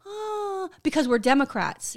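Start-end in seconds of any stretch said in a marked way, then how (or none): 0.1-0.7 high sympathetic "Oh" breathed out; dramatic sigh. Retold or imitated. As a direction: sigh along with a moved crowd. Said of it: "the crowd's like".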